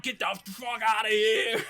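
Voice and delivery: hammed-up yell to scare off an intruder; mock angry shout. Acting mother yelling at intruder.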